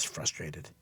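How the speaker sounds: usual speaking voice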